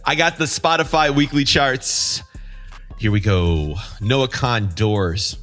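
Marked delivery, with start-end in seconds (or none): none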